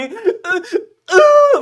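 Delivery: high, loud, slow hammed-up wail; mock sobbing wail. Grown boy bawling over the dead hen, mock wail. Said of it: "막 이렇게 우는 거예요".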